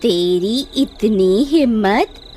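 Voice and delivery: very slow, stretched-out threat; menacing outrage. Acted. Witch answers the boy's challenge, slow menacing outrage. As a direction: draw out each word in menace.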